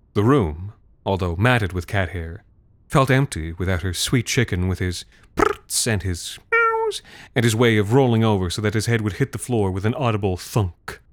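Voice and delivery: usual speaking voice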